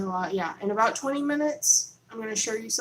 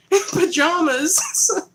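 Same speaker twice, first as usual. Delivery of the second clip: laughing; 9 semitones higher, 9 dB louder than usual. Real, not acted. Laughing about choir robe outfit.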